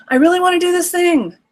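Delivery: put-on high, begging kid voice; pleading child. Kid asking parent again, high pleading child voice. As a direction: beg like an eager nine-year-old. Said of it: "So the kid asks again".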